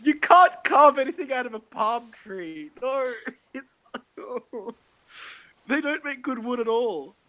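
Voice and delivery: high-pitched, half-laughing objection; incredulous protest. Real, not acted. Protests about palm wood, laughing.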